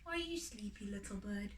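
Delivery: hushed and soft, lulling the chick; soft mother bird bedtime hush; soft whisper to child. A narrator's reading. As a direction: whisper tenderly to a sleepy child. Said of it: "whispering slowly".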